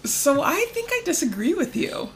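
high-pitched, put-on contrarian; feigned disagreement. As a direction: pretend to object, barely keeping a straight face. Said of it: "I can't even keep a straight face".

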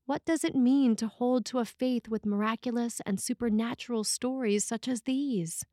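usual speaking voice

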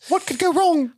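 high squeaky elf; Dobby voice. Retold or imitated. Co-host playing Dobby in a high elf squeak. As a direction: squeak the line like a proud house elf.

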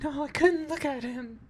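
high, girlish imitated voice. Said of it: "Excellent girl impression".

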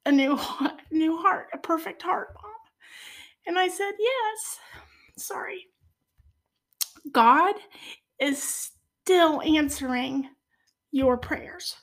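choked up, voice lifting high with feeling; emotional. Real, not acted. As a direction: fight back tears while pressing on. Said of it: "sorry, I get emotional".

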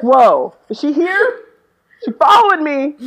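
loud, high startled whoa; mock surprise. Joking to friend about a voice double, mock startled. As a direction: act spooked as if she appeared. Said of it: "I was like".

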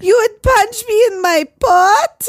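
very high, loud, drawn-out squeal; mock shocked girlfriend. Girlfriend reacts to the punch threat, loud high mock shock. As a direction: gasp and stretch it out in a put-on voice. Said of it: "he tells her if she had".

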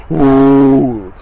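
loud breathy blow onto flames. Retold or imitated. Fanning the dying fire with a loud blowing breath.

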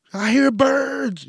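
high, loud, slow yell of "I hear birds". Pretend. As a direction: shout it across the store like you've lost it. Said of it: "You're just yelling".

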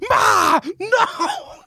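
high, yelled "Ma! No!"; screaming at mom. Retold or imitated. Grown man acting like a high schooler, yelling up at mom. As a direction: scream it like a teenager in the basement. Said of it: "I was screaming".

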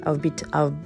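usual speaking voice

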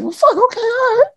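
high, squeaky flustered reply. Retold or imitated. Son on the phone answering dad in a high squeak. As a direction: let the voice jump up in panic.

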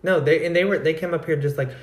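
usual speaking voice

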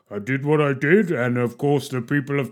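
Geoffrey Cox answering his critics like Mufasa. Retold or imitated. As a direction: play the politician as a grand lion king. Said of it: "he did his pure Mufasa".

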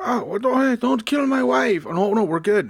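high, hammed-up pleading; mock panic. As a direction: plead loudly in a high, put-on voice. Acts out revived Mussolini panicking.

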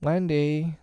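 put-on high child's protest; small boy objecting; remonstrating. A narrator's reading. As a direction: call her name in protest, pitched high. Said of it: "remonstrated Michael".

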